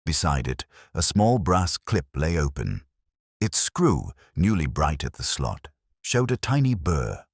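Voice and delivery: plain reading voice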